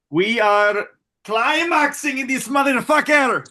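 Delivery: high, loud mock Irish brogue; a rowdy Irish pub cheer in a put-on accent; hammed-up Irish accent. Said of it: "spot on Irish".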